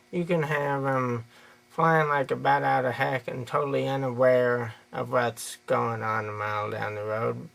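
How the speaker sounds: normal talking voice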